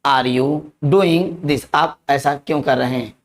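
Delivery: ordinary talk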